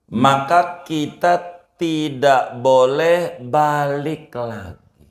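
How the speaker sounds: slow high sermon delivery; emphatic preaching. Acted. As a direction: drive the rule home to the congregation, slowly. Preacher states the rule emphatically.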